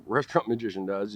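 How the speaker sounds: ordinary delivery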